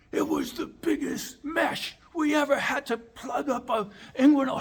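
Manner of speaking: mock amazed doctor. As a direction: marvel at it with a laugh. Doctors marvel at huge mesh.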